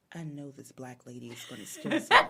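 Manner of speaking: low, imitated suspicious thought; mock-suspicious low voice; suspicious onlooker. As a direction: drop the pitch and voice her suspicion.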